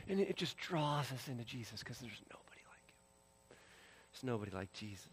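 soft, sinking near-whisper; quiet wonder, fading almost to breath; hushed awe. Real, not acted. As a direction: let the voice drop away in reverence. Preacher hushed in awe of Jesus.

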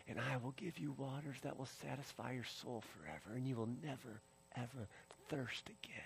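very soft, hushed reassurance; gentle promise, fading to near silence; tender promise. Acted. Voices Jesus gently inviting the thirsty.

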